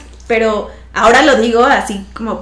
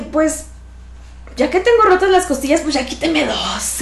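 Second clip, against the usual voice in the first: joking request; 4 semitones higher than usual. Retold or imitated. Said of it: "Y le dije".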